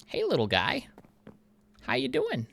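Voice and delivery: high, sweet voice for a tiny bee; high baby-talk greeting; cutesy high voice. Acted. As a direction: coo at the little guy. Talking to a bee.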